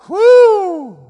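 a high, loud shout of praise; joyful whoop. Real, not acted. Preacher whooping to the congregation at the sermon's peak.

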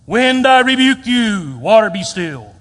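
booming, slow, theatrical command; exclaimed rebuke. Acted. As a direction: thunder it out like a hammed-up prophet. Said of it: "it doesn't even have an exclamation point".

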